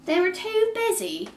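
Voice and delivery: very high, loud, drawn-out witch; piercing storybook witch screech; witch's shriek. A narrator's reading. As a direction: shriek it slowly in outrage. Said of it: "the witch shrieked at Kate".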